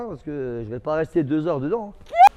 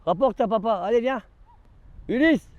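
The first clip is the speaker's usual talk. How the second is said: raised coaxing dog call; higher singing call to the pet; 6 semitones higher than usual. Real, not acted. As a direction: urge the dog over, pitched up. Calling the dog to fetch.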